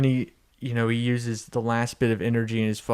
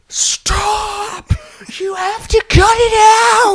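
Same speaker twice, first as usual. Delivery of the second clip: very high, drawn-out desperate scream; hammed-up screaming protest; Grinch; 8 dB louder, much slower than usual. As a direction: shriek the plea at full stretch. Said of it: "the Grinch arrives".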